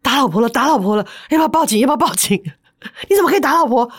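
narrator's panicked inner voice; alarmed inner thought. Retold or imitated. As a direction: fret aloud as if about to call the police. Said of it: "我心里还想".

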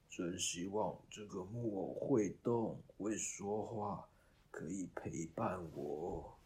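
put-on slow, hushed old man's murmur; murmuring to himself. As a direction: murmur it slowly, fading to a whisper. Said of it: "薛贝特爷爷喃喃自语".

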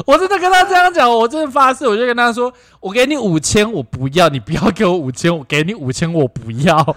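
high, loud laughing retelling. Retold or imitated. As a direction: babble it through giggles. Retells panicked babble, laughing.

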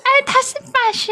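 put-on baby voice. Shown as a demonstration. Keeps the baby voice.

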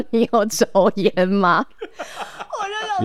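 slow, high, laughing read of the form; reading the smoking question on the form, laughing in panic; laughing dread. Retold or imitated. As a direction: drag it out while laughing at the form. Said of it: "上面有写说".

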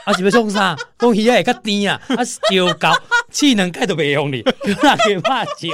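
loud, high exasperated complaining; exasperated loud rant. Retold or imitated. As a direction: rant about it loudly. Loud ranting about dad's MSG.

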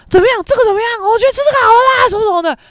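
high, fast, pushy mock boyfriend; frantic again. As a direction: imitate him flipping out over where to eat. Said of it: "他就疯啦".

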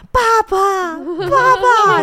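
high, loud imitated child's wailing; crying for dad. Mother imitating daughter crying after dad, high loud wailing. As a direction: wail like a toddler left behind. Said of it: "在那边".

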